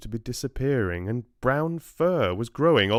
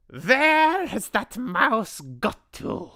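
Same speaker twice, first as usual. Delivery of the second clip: high, loud, slow witch voice; a witch hunting for the mouse; witch's snap; 13 semitones higher, 5 dB louder, slower than usual. A narrator's reading. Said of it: "snapped the Grand High Witch".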